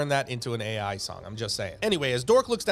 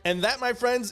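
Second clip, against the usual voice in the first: ordinary delivery, nothing marked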